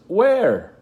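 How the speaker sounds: mother calling where. Retold or imitated. Mother searching for invisible son, calling loudly. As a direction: call out, searching. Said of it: "she says".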